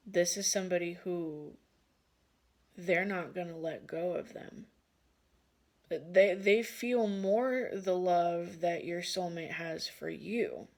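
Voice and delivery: ordinary delivery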